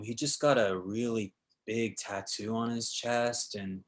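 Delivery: lightly voiced stoned eighteen-year-old; a hint of a spaced-out cafe kid, slowing down; stoned teenager. Actor describing a cafe coworker, lightly voiced stoner. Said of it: "stoned out of his gourd".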